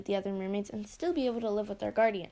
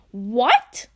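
shocked scream, much louder; 13 dB louder than usual. Acted. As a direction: scream it in disbelief. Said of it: "I screamed".

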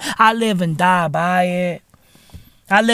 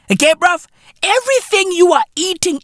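normal talking voice

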